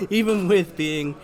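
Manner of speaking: laughing. Real, not acted. Laughs while reading message.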